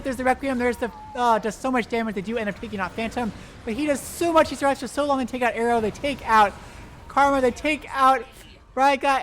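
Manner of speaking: live caster in a big teamfight; excited play-by-play. Real, not acted. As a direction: ride the fight at full hype.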